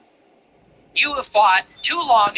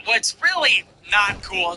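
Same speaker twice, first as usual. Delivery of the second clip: ordinary talk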